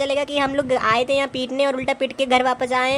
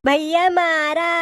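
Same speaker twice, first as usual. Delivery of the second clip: put-on whiny child; 6 semitones higher, much slower than usual. Child complaining to big brother.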